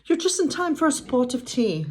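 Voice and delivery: high-pitched British character voice; attempted British accent. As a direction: try a cheery tea-time British accent. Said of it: "trying to do the British accent".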